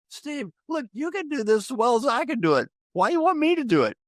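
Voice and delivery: retold plea in a high, exasperated voice; high-pitched protest. Acting out his protest to Steve.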